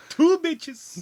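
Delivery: high, loud cartoon count; vampire Count voice. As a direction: join in the Count's counting bit. Joins the vampire counting bit.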